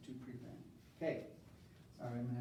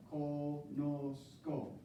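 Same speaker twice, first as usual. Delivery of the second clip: very slow, drawn-out syllables; much slower than usual. Shown as a demonstration. Teacher modelling a verb form for students, syllable by syllable. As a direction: stretch each syllable out separately.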